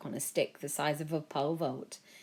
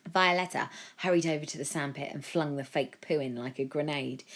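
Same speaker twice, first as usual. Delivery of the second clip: ordinary delivery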